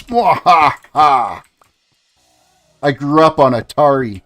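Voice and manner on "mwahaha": slow, theatrical villain laugh; hammed-up mad-villain laugh; mock evil laugh. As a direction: draw out a gloating "mwahaha". Mock villain laugh after boasting.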